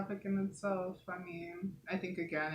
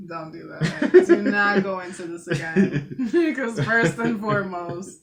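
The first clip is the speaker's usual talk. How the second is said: loud, laughing, begging to stop; laughing protest; 3 semitones higher, 13 dB louder than usual. Real, not acted.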